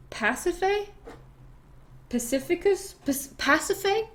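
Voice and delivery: high questioning rise on each try; tentative attempts at a hard name; unsure pronunciation. Real, not acted. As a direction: sound out the name doubtfully. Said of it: "I think her name is".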